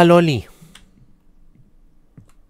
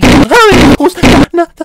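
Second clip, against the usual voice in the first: sudden loud jump-scare yelp; real fright bursting out loud; startled yelp; 11 semitones higher, 14 dB louder than usual. Real, not acted. Gamer jolts at a scare in the game, a loud startled yelp.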